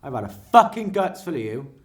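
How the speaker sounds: loud furious yelling; angry shout. Retold or imitated. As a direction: spit the insult out. Street row, quoted insults.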